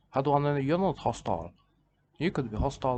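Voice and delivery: normal talking voice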